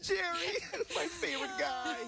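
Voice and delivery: hammed-up graveside wail, half laughing; mock sobbing. Joking fake mourning at the casket.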